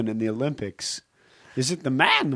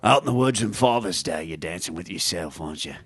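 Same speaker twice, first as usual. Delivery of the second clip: [name] impression; 5 semitones lower, 5 dB louder than usual. Shown as a demonstration. Comedian showing his new impression to hosts, sneering rocker. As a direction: lower sneering rock-star growl, dropping low. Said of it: "I've been working a lot on, uh, [name]".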